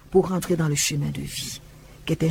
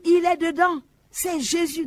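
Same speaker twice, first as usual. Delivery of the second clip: agitated accusation; 12 semitones higher than usual. Retold or imitated. Distraught woman accusing Jesus.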